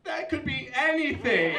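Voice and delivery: high, loud comic protest; exasperated outburst. Acted. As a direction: burst out in baffled exasperation. Exasperated reaction to vague pitch.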